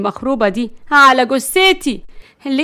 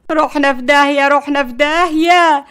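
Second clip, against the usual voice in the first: loud, raised-pitch doomed wail; panicked wailing; 4 semitones higher, 5 dB louder than usual. A narrator's reading. Grandmother slaps her own face before the crash, wailing. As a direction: wail it over and over. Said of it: "بدأت نرجس تلطم على وشها".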